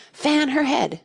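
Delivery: high, loud, urgent order; alarmed call for help, acted; anxious interruption. A narrator's reading. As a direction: cut in anxiously. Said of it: "anxiously interrupted".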